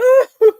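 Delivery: high wailing sob, retold; imitated sob. Killer breaking down to his girlfriend, imitated wailing sob. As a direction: mimic his sudden wail. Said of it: "he was just a crying".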